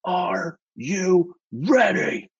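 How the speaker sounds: very slow, high-pitched mock scream; imitated scream. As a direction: stretch each word into a strained mock yell. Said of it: "he screams in my face".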